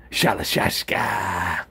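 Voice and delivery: low, slow Snake voice; Solid Snake voice. Acted. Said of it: "I should definitely apply for, like, voiceover".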